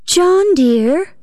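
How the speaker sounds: high, gentle coaxing call; sweetly gentle, high and lilting; gentlest voice. A narrator's reading. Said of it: "said I in the gentlest voice".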